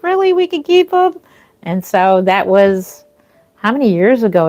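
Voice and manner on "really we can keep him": put-on high, thrilled disbelief as a kid would ask; excited disbelief. Retelling the moment she learned they could keep him, high excited disbelief. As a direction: retell the moment you heard the dog could stay. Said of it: "And I'm like".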